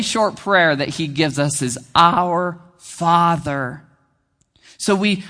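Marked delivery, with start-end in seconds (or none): 1.9-3.8 "Our Father" said very slowly; drawn-out, prayerful "Our Father"; slow and reverent. Shown as a demonstration. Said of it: "That's how Jesus starts this model prayer".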